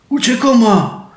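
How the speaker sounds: a high, scared yelp of warning; whimpering cry. A narrator's reading. Fox yelping to the wolf to flee, high and scared. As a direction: yelp it like a frightened fox fleeing. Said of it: "zaskomlał lis".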